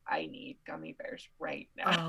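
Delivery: low whispered gummy bear longing; half-joking whispered craving. Retold or imitated. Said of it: "I was like".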